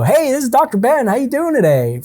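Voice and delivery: cheery doctor greeting. Retold or imitated. Doctor answering his own phone, overly chipper. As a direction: greet like an upbeat stranger. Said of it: "they don't answer the phone and go".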